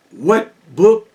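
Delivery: normal talking voice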